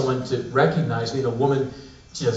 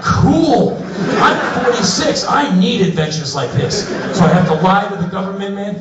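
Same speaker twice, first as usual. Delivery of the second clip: much higher and louder, laughing excitement; mock thrill; 7 semitones higher, 5 dB louder than usual. Traveler told to lie to the government, mock thrilled. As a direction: pump yourself up for danger.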